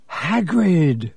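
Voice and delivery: drawn-out cry of relief; relieved croak. A narrator's reading. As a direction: greet a rescuer, lightly croaked. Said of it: "Harry croaked in relief".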